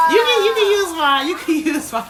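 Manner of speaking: high, loud, laughing offer; joking, laughing. Real, not acted. Mom jokingly offers viewers her kids, laughing loud. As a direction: toss it off through a laugh.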